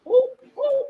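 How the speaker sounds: high, drawn-out gasping ooh; shocked ooh. Real, not acted. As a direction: react like you just heard juicy gossip. Reacting to shocking news.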